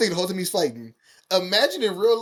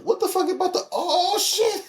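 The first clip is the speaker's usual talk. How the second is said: mock shock; 10 semitones higher than usual. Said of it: "And I was like".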